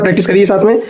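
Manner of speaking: ordinary talk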